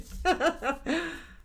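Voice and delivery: high, loud burst of laughter; real laugh. Real, not acted. Laughing at her pigs in slop line with viewers. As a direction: crack up at your own joke.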